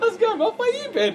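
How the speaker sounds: very high, sing-song innocent voice; hammed-up clueless cheeriness; mocking the bandmates' carefree hello, high and sing-song; mock breezy greeting.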